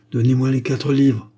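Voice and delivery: ordinary delivery, nothing marked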